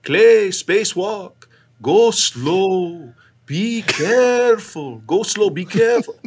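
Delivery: high, drawn-out words of warning; slow, lilting mock-Fyodor caution; imitated Russian cosmonaut. Russian crewmate cautioning astronaut before spacewalk, slow high imitation. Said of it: "he'd go".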